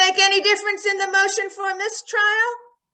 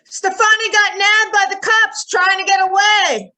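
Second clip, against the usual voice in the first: reporter calling out; 10 semitones higher than usual. Acted. Reporter shouting news at the judge's house, calling out. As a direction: call it out over a crowd.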